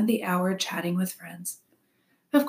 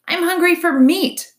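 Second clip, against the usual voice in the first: high, loud, indignant fox voice; 11 semitones higher, 9 dB louder than usual. A narrator's reading. Fox rejects the robot's berries, loudly indignant. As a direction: burst out in disgusted protest.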